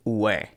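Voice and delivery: ue pronounced "weh"; ü sound. Shown as a demonstration. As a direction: sound out ue as a w glide into e. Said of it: "tells you to pronounce it like".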